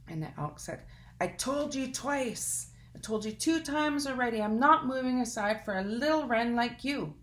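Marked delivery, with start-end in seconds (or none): 1.2-7.1 higher, louder exasperated elk; fed-up elk. Acted. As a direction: snap it out, out of patience. Elk refuses third time.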